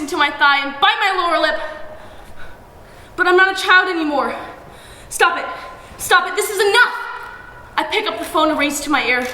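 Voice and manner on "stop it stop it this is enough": firm cry. A narrator's reading. Girl finding resolve.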